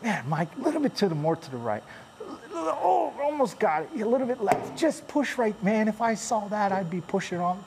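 high, fast, bossy viewer voice; backseat viewer. Pretend. Viewer coaching Mike from the camera, high mock bossiness. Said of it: "you're probably going".

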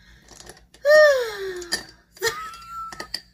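a big frustrated groaning sigh, way up high and loud; loud exasperated groan. Real, not acted. Fed up with the powder, a loud high groan.

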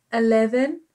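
ordinary delivery

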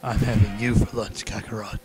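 villain voice. Pretend. Dragon Ball villain threat.

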